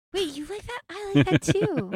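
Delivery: put-on high, delighted little kid. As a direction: squeal it like a thrilled child. Acting kids on a playground.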